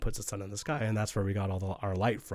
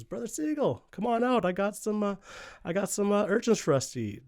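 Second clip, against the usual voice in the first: high, sing-song sly call through the door; Raven calling out; 11 semitones higher than usual. Retold or imitated. Raven calling his brother out to eat, high and coaxing. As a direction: coax him out in a high bright call.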